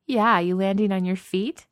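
mocking, like sharing a snide joke; snickering. A narrator's reading. Katie backs up Mark's jibe with a snickering taunt. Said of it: "Katie snickers".

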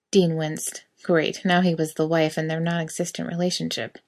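ordinary delivery, nothing marked

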